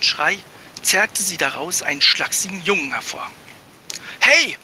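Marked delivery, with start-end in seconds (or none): 4.2-4.6 sudden high, loud yelp of surprise; a boy caught off guard, crying out; startled cry. Acted. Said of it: "brachte Barley hervor". (erschrockener, lauter Aufschrei)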